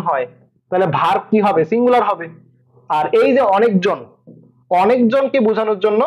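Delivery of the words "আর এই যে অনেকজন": usual speaking voice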